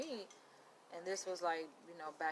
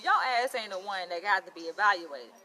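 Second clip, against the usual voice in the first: half-joking loud comeback, said much louder; mock loud retort; 10 dB louder than usual. Loud retold retort to the professor's dare. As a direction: bark it back as a playful retort.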